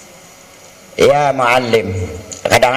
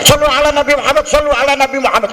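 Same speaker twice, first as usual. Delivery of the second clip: higher, fast repeated call to bless the Prophet; hurried, raised plea to stop fighting; urgent call for salawat; 9 semitones higher, much faster than usual. Retold or imitated. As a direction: rush it out to cut the quarrel short. Calling to stop a quarrel.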